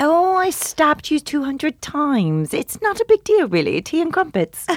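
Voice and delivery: high, prim mock British voice; British accent. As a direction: make it sound lovely in a high British accent. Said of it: "with a British accent, everything is so much nicer".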